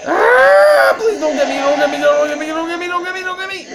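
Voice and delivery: high panicked begging; terrified pleading. Real, not acted. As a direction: beg in real terror. Chased by monster, pleading.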